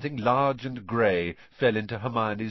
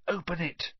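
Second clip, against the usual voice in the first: urging; 8 semitones higher than usual. A narrator's reading. Boy presses friend to open the letter, urging. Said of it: "Neville urged".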